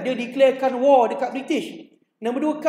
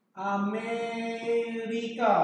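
very slow and softer, stretching Amerika; drawn-out keyword; 6 dB quieter, much slower than usual. Shown as a demonstration. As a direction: hold the word for students to recall. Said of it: "ulangkaji dengan murid". (sebutan sangat perlahan, Amerika dipanjangkan)

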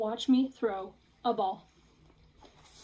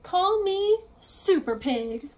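boastful; 8 semitones higher, 6 dB louder, much slower than usual. Acted. Piggy boasting.

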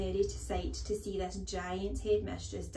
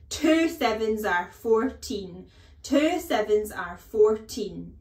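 very loud, slow, drilled-in bellow; 3 semitones higher, 13 dB louder, slower than usual. A narrator's reading. Headmistress drilling answer into dangling boy, bellowing. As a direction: hammer each word at full volume. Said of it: "Miss Trunchbull bellowed".

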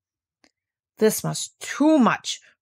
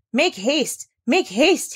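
urgent, higher call of make haste; hurried cry; 6 semitones higher, 3 dB louder, much slower than usual. Acted. Butler hurrying the girl inside, urgent. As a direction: rush her in, urgent. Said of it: "he cried in a hurried voice".

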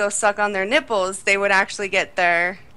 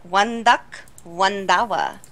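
Miss Swan; 3 semitones lower, slower than usual. Retold or imitated. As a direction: count out prices in a mock accent. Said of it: "Miss Swan from Mad T V when she's like".

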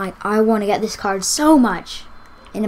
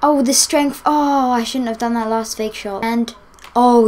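ordinary talk

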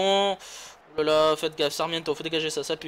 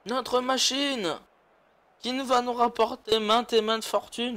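ordinary talk, nothing marked

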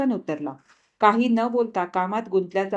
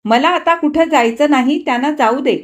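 irritated insistence; 6 semitones higher, 6 dB louder than usual. A narrator's reading. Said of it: "त्रासिकपणे म्हटले".

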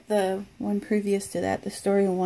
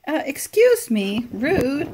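offended, raised pitch and volume; affronted little scolding; indignant; 5 semitones higher, 5 dB louder, slower than usual. Real, not acted. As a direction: tell off a rude gadget, higher and louder. Device refuses to say goodbye.

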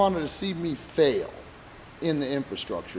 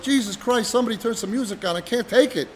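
exasperated outburst; 7 semitones higher than usual. Retold or imitated. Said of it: "I'd be like".

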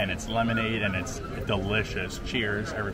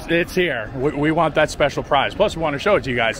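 loud excited; 4 semitones higher, 7 dB louder than usual. Real, not acted. Hunting flowers.

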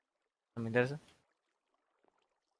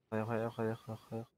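cursing under breath; 6 semitones lower, 20 dB quieter than usual. Real, not acted. Running out of air in game.

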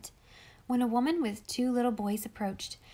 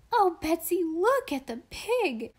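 put-on high, loud child's squeal; little girl shrieking with delight; excited scream; 8 semitones higher, 4 dB louder than usual. A narrator's reading. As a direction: call out thrilled, pitched way up, drawing out the words. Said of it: "screamed Molly again".

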